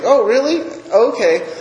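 high, knowing "oh, really"; mock surprise. Therapist hearing client's lie, knowingly surprised. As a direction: lift it high, calling the bluff.

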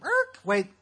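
high, loud mock screech to a stop; abrupt halt. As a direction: cut in sharply like brakes catching, playing it up. Acts out stopping his departing friend.

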